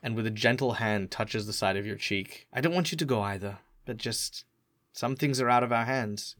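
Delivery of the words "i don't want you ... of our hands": soft, hushed consoling voice; quiet, caring foster worker; tender reassurance. Acted. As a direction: gently let a child down. Carer tenderly consoles the boy.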